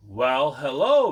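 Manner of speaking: usual speaking voice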